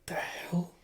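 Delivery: hushed, voiceless aside; stunned whisper. Real, not acted. Reader reacts to Zeno charging in, stunned whisper. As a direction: whisper it in disbelief.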